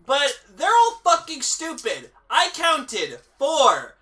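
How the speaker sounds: slow, loud, exasperated ranting. Real, not acted. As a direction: vent at the movie, slow and loud. Ranting about the movie.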